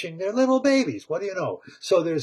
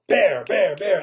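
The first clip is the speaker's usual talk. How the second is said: calling out; 3 semitones higher, 6 dB louder than usual. Acted. Mei Mei calling Bear.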